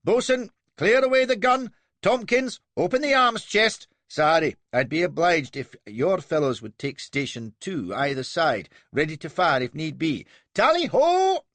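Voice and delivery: high, loud, keen naval orders; young officer rallying his crew; eager young captain. A narrator's reading. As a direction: bark orders like a boy hero.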